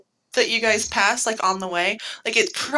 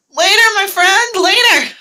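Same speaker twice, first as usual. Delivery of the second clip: loud, high, drawn-out hearty call; jovial farewell; 11 semitones higher, 9 dB louder than usual. Acted. Belle calls after departing Jim, loud and jovial. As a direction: holler a big jovial goodbye. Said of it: "just going to jovially just be like".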